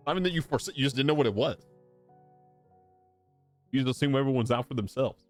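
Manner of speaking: ordinary talk, nothing marked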